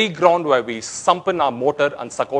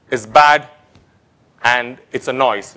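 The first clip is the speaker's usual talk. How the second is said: usual speaking voice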